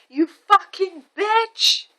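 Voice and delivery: high, drawn-out mock scream; retold scream. Angry driver screaming at mom, high mock scream. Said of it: "start screaming at me".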